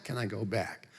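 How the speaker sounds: suddenly soft, small question; hushed end to a rant; deflated self-quote. Acted. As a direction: drop to a quiet, sheepish murmur. Ends rant, question repeated small.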